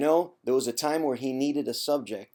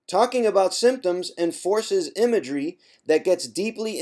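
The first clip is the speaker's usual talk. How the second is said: loud reading; 6 semitones higher, 8 dB louder than usual. Acted. Reading aloud from the book.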